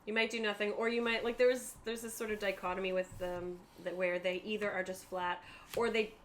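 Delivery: normal talking voice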